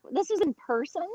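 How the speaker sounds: "This is in person?" asked with a high surprised rise on person; disbelieving, rising at the end; surprised question. Real, not acted. Disbelief at American abroad.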